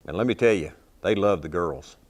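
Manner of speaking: usual speaking voice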